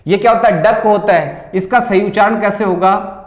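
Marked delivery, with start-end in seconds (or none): none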